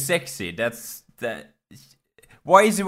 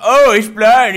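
mocking bully voice; 5 semitones higher, 8 dB louder than usual.

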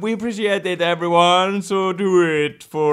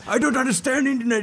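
fast, high confused voice; clueless old person voice; 8 semitones higher, faster than usual. Acted. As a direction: play someone lost online. Mocking an internet-hater.

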